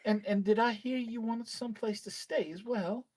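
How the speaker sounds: high, quick chatty innkeeper lady; bustling little innkeeper, eager to help; innkeeper woman voice. Acted.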